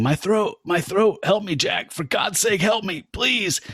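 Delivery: high strained begging for help; mock choking cry for help; choking plea. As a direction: plead like a man being hanged. Choking man begging while hanged.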